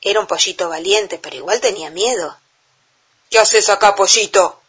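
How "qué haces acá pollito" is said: a touch of a booming giant; roaring giant. A narrator's reading. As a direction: growl it like a grumpy giant. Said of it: "rugió el gigante".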